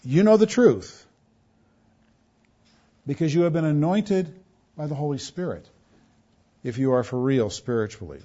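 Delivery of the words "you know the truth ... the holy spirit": usual speaking voice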